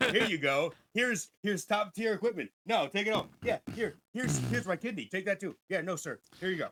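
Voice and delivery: loud, high, overeager shopkeeper voice; eager gun vendor. Pretend. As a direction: push gifts on a newcomer, gushing and loud. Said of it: "I'll be like".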